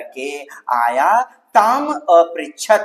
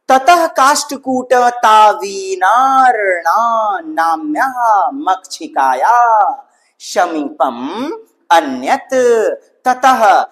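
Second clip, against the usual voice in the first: higher, louder recited reading; teacher reading the next Sanskrit line aloud, recitation style; 4 semitones higher, 4 dB louder than usual. Acted. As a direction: declaim the text line.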